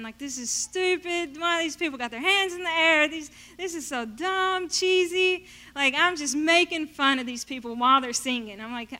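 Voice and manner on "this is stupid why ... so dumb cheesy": higher, sneering put-down; mocking scorn. In the back row mocking people singing, higher sneering scorn. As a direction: make fun of the worshippers. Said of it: "mocking, making fun".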